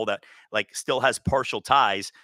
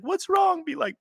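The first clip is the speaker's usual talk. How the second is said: very high-pitched mock sympathy; mock concern. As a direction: ask in a silly high falsetto. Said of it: "supermodel's crying and they're like".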